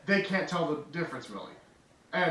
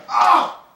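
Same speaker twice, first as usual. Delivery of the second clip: pained yelp; 10 dB louder than usual. Acted. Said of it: "He's like".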